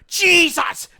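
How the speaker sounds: stretched, high-pitched mock yell; yelled move name. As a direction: belt it out like a fighting game hype call. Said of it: "he would go".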